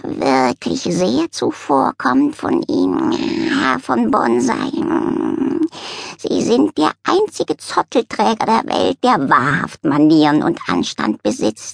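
high, slow cat voice, purring with pleasure; a grand cat doling out praise; cat thanking the dog graciously; flattered cat. Acted.

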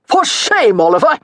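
high-pitched cry of reproach; calling out. A narrator's reading. Baron rebukes the duelist who fired unfairly, calling out. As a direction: call out your censure to the man on the field. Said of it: "Baron Pottier called from the sidelines".

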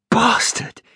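high strangled cry; choked burning yelp; pained outburst. Acted. Liam burned by hot drink.

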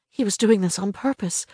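ordinary delivery, nothing marked

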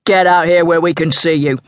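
lightly voiced armed thug; a hint of a menacing man; gruff order. A narrator's reading. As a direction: order the fugitive out.

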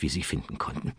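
ordinary delivery

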